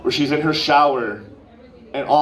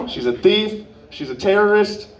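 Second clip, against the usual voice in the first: mock outrage; 7 semitones higher than usual.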